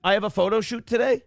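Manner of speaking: high quick startled question; surprised. Real, not acted. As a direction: caught off guard. Caller's surprise about photo shoot.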